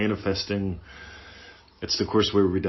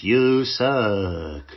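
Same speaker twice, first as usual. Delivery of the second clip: louder, half-hearted chipmunk "You suck."; a badly faked chipmunk squeak on "You suck."; 2 semitones higher, 6 dB louder than usual. Inner critic as a poor chipmunk, louder and half-hearted. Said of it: "that's probably the worst Alvin and the Chipmunks ever".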